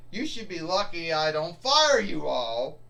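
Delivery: plain reading voice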